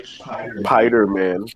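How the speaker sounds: "Spider-Man" said slowly as 'Pider-Man; dropped S. Retold or imitated. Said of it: "I could not pronounce S's".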